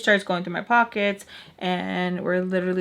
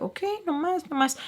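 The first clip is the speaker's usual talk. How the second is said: much higher, softer surrender; mock backing off; 9 semitones higher, 4 dB quieter than usual. Mister Peach backing off from her attitude, mock surrender. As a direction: retreat in a high, light voice. Retelling his backing-off reply.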